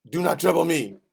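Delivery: loud, high mock French accent; indignant friend in hammy French. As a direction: bellow it with a put-on French accent. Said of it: "said it with a French accent".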